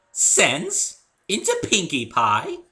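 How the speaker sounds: very high, loud pony disbelief; fake-shocked Rarity gasp; theatrical incredulity; mock incredulous. A narrator's reading. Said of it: "would-be incredulous way".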